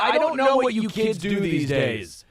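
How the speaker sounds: high, worked-up mock rant; loud, high-pitched playful bafflement; exasperated old-timer. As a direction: throw up your hands at kids these days. Mock exasperation at kids' slang.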